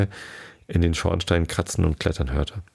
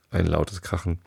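ordinary delivery, nothing marked

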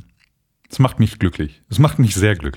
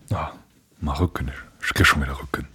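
whispered complaint; 5 semitones lower, 7 dB quieter than usual. Real, not acted. Mock complaint about his back.

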